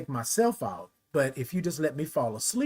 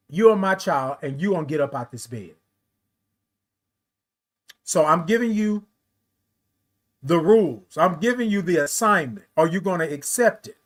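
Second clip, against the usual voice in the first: God's command; 3 semitones higher, 5 dB louder than usual. Retold or imitated. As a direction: hand down the assignment with force. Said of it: "That's how it came across".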